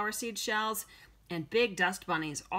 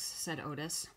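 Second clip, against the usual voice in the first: ordinary delivery, nothing marked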